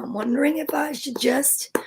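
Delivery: soft whispered aside; whispering. Real, not acted. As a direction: whisper it to yourself. Thinking aloud aside.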